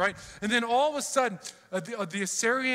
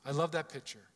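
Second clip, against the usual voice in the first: ordinary delivery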